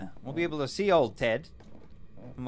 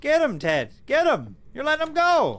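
high, loud urging shout; calling from far away; 10 dB louder, slower than usual. Real, not acted. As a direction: cheer him on from afar, loud. Egging ghost on loudly.

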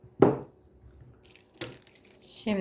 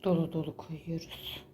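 soft unvoiced whisper; 6 semitones lower, 10 dB quieter than usual. Real, not acted. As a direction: whisper it under your breath. Aside while filling the dough.

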